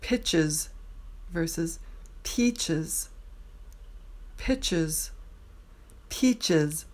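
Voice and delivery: pitches short i, peaches long ee, clearly contrasted; short versus long i. Shown as a demonstration. Teacher contrasting pitches and peaches. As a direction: set pitches against peaches.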